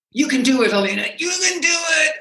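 high, pushing "You can do it" toward a shout; self pep talk. Retold or imitated. Stroke patient willing herself to speak, in a rising pep talk. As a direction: urge yourself on, voice climbing. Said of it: "frustrated with myself".

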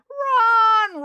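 very high, held shout; crowd roar. A narrator's reading. Crowd answering Brad, roaring. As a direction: answer as one excited crowd. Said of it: "roared the crowd".

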